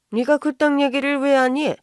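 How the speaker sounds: affronted mother-in-law's scolding question; indignant challenge. Acted. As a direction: snap at someone meddling in your business. Mother-in-law challenges the meddling daughter-in-law.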